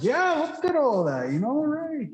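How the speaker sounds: high, slow and proud; boastful showoff voice. Retold or imitated. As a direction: show it off, grinning.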